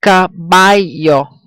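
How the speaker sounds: Caballo, slowly, hitting BA; slow ca-BA-llo for Caballo; second-to-last stress. Shown as a demonstration. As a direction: stretch Caballo, weight on the middle syllable. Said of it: "Second to last".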